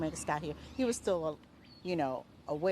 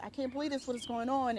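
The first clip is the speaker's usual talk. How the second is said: higher-pitched quote of a stunned victim; retold disbelief; 8 semitones higher than usual. Neighbor quotes the wounded teen who couldn't believe it, retold disbelief. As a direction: retell his disbelief, pitch lifted. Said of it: "he really couldn't believe it was happening".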